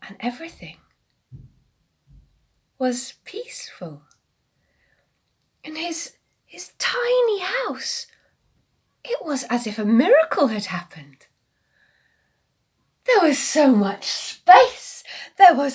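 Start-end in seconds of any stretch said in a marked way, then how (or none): 0.1-11.3 soft unvoiced whisper; hushed whisper. A narrator's reading. Narrator telling children of the peaceful house, whispering. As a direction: breathe it out like a secret. Peace after animals gone.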